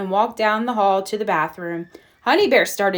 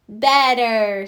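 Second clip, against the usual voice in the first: lightly voiced baby. Baby Honey Bear voiced.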